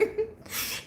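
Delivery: airy silent laugh; breathy laugh. Real, not acted. Laughing at the story.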